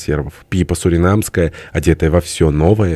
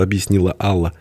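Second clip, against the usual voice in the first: usual speaking voice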